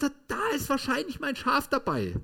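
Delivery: put-on high, pleading child's voice; a small boy crying to his papa; boy runs to his father, voiced high and upset; distraught little boy. Boy at butcher's pen.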